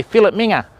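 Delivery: "fillet minger" as FILL-it MING-uh; broad Aussie "fillet minger". Shown as a demonstration. Mocking the Aussie version, "fillet minger" as FILL-it MING-uh. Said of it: "in Australia we go".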